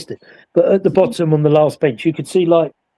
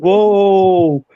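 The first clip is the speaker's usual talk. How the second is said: amazed whoa; 4 semitones higher than usual. Retold or imitated. Startled reaction retold.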